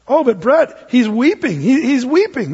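objecting listener. Retold or imitated. Imagined congregant objecting.

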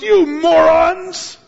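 loud, high, slow angry shout; furious. Retold or imitated. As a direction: hurl the insult at them. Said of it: "getting so mad".

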